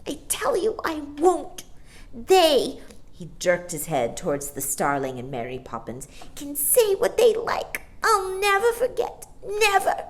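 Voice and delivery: put-on high, earnest baby vow; soft fierce child. A narrator's reading. Baby John vowing to Barbara, high and fierce. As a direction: vow it fiercely to his sister. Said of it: "softly and fiercely called to Barbara".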